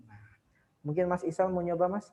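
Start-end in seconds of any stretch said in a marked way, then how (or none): none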